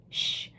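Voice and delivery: sharp quiet shush; hushing. Acted. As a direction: hush them fast. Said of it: "Dolly hushed her up".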